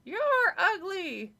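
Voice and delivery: high, slow, through gritted teeth; ventriloquist's high dummy voice; squeaky dummy voice. Acted. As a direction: speak for the puppet without moving your lips. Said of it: "in Lindy's squeaky voice".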